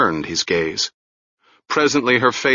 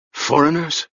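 a lightly hoarse, higher question; 6 semitones higher than usual. A narrator's reading. Old woman hoarsely questioning the foreign strangers. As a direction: rasp the word out, wary.

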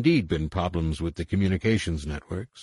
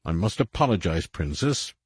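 plain reading voice

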